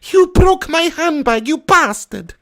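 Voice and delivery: high, slow granny voice; Mrs Doubtfire. Acted. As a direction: shriek it like a cross old nanny. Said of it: "Give me a Missus Doubtfire pig".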